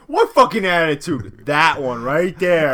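play-pick a fight with your buddy; mock aggressive. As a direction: fake New York hostility, slow on the last line. Mock quarrel showing Northeast attitude.

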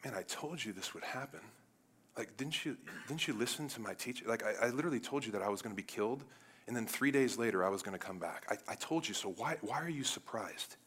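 soft, hushed voicing of let-down thoughts; gentle, not-mad reproach. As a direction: chide friends quietly, without anger. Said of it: "mad and hating them, but he's like".

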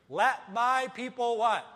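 loud, slow, rising call; slow booming preacher line; booming proclamation. Acted. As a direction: proclaim it to the congregation. Preacher booms the famous line.

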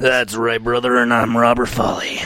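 gruff wrestler catchphrase, half-whispered; Hulk Hogan impression. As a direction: rasp it like a pro wrestler. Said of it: "Are you doing Hulk Hogan?".

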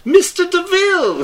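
very high, loud, slow mock call. Calling for the tailor: high loud call. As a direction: sing out the name like a fan.

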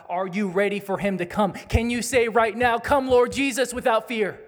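loud, high, urgent pulpit questions; impassioned preaching. Real, not acted. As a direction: plead with the church at full force. Pastor presses congregation with questions.

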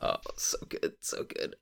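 breathy whispered so good, barely voiced; hushed, delighted whisper; whispered relish. Real, not acted. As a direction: whisper it like a guilty pleasure. Savoring the terrible movie.

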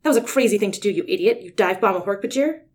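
loud, fond scolding. Acted. Rachel scolds Tobias for his reckless dive, loud and fond. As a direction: scold him like a relieved friend, loud. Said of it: "affection into that one insult".

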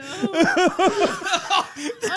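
high-pitched helpless laugh; helpless laughter. Real, not acted. Friend watching another gag into a bag, cracking up.

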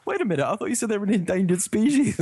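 high-pitched, playfully baffled protest; mock incredulous objection. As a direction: object like a puzzled viewer catching a contradiction. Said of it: "It's like".

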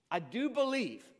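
desperate cry. A narrator's reading. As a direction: plead it harder the second time. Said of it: "the father instantly cried out".

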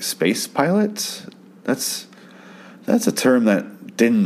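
slow, high disbelieving question; incredulous. Retold or imitated. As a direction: stretch it out in disbelief. Baffled at an odd term.

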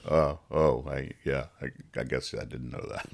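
low, very quiet embarrassed mumble; sheepish admission. Acted. College friends answering the bartender, sheepish. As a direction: stammer it small and low, caught out.

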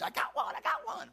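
acted cry of a catch, pitch leaping on the repeat; excited fisherman. Fisherman who just got a bite, excited. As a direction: call it out like you've hooked one. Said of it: "You know how they catch a fish?".

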